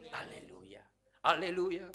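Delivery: ordinary talk, nothing marked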